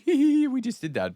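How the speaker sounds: high sneaky giggle; giggly. Retold or imitated. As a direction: giggle like you got away with it. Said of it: "coming back and be like".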